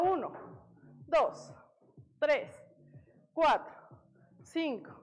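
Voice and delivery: loud, steady rep count with a drop on each number; coach counting reps. Acted. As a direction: call out each number to the class.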